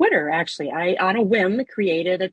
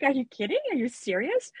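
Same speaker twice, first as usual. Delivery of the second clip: higher-pitched, incredulous questioning; retold disbelief; 6 semitones higher, 5 dB quieter than usual. Podcaster re-enacts reaction to listeners' praise, incredulous. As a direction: act out not believing the compliment.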